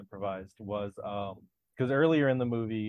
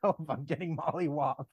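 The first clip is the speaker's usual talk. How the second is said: imitating Donnie; 4 semitones higher than usual. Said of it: "And Donnie's like".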